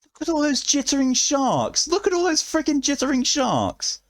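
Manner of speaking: high squealed delight; squealing excitement. Real, not acted. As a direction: squeal at the spam. Emote spam of sharks.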